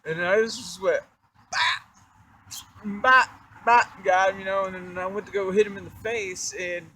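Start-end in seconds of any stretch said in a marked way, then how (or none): 1.5-4.0 punch sounds. Retold or imitated. Imitating punches.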